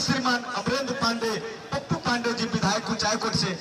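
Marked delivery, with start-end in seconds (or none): none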